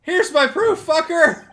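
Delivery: loud, high victorious shout; gleeful yelling; triumphant cheering. Real, not acted. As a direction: crow it out in triumph. Triumph at finding the proof.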